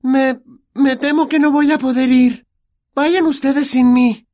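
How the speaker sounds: hesitant, stammering refusal in a high pitch. Acted. Hermione behind the door refusing to come, high and hesitant. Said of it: "Una voz aguda le contestó".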